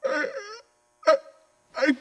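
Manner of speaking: tearful, halting plea, high voice; в слезах, надломленно. Acted. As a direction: break down, words coming slowly between sobs. Suspect breaks down under interrogation.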